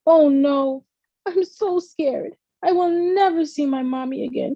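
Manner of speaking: put-on a child overcome by fear; scared crying. A narrator's reading. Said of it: "Grace cried".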